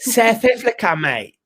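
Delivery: high start, hammed-up South African accent; cocky mock South African, dropping on the last word. Shown as a demonstration. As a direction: show off a badly faked South African accent. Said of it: "perfect South African accent".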